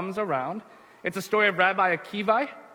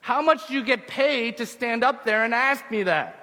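raised, higher-pitched call; shouting back up; 5 semitones higher than usual. Acted. Rabbi answering the guard above him, shouting back up. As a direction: call it up to someone on a wall. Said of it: "he shouts back up".

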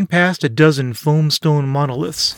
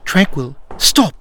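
sharp snap. A narrator's reading. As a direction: snap it with an edge. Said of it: "Silence snapped, an edge to her voice".

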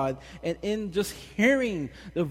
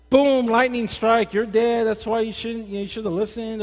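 mock wrathful God; 7 semitones higher, 7 dB louder than usual. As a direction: thunder it out like an angry Old Testament God. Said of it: "go all old school, Old Testament".

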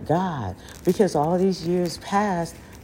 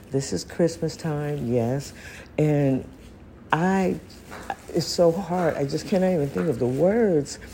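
normal talking voice

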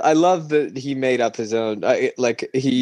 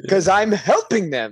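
slow, high innocent excuse; 6 semitones higher than usual. Pretend. Voicing the thief's excuse.

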